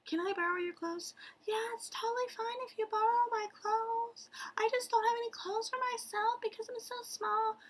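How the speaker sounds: tiny, squeaky-high princess voice; high-pitched anime princess. Acted. Shrunken princess lends her clothes, high-pitched mock voice. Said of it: "really high-pitched voice like that Princess So-and-so".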